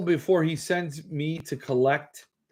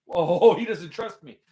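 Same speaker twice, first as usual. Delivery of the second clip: ordinary talk, nothing marked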